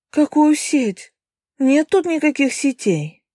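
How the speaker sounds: put-on high, slow, indignant girl's voice; indignant young woman. A narrator's reading. Girl protests rescue net.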